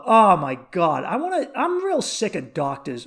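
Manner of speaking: ordinary talk, nothing marked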